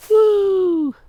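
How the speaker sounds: high, loud whoop of joy; excited whoop. Real, not acted. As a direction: let out a gleeful woo. Shooting off fireworks.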